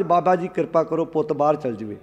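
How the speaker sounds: low, soft imitated plea; understated mock supplication; humble request. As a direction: ask meekly, dropping the voice. Devotee pleading with baba, acted.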